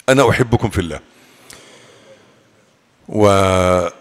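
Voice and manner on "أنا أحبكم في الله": normal talking voice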